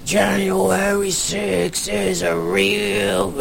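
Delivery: slow, drawn-out deep gravelly singing impression; Robbie Roadsteamer. Comedian trolling a rally crowd in song, deep gravelly impression. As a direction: imitate a gravelly troll singer, stretching every word. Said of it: "very deep and gravelly voice".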